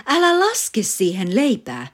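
a sharp, loud, high cry of alarm; shriek. Acted. Mother shrieking at father to save her print. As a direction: yelp it out to stop him in time. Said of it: "äiti parkaisi".

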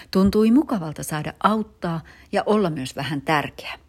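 usual speaking voice

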